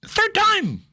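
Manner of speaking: very high, incredulous exclamation; squealed mock outrage; indignant outcry. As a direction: cry it out in disbelief. Indignant retelling of being hit.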